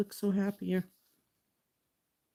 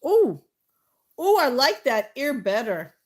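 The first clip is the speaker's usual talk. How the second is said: high, louder delighted oh; 7 semitones higher, 9 dB louder than usual. Real, not acted. Painter pleased with the mermaid's ear, delighted. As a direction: gush over the new ear.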